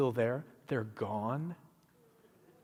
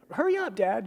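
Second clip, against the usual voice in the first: put-on kids calling; 9 semitones higher than usual. Kids running ahead to pool.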